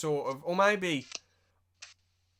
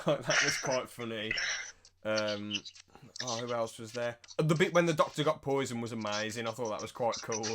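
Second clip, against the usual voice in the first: ordinary delivery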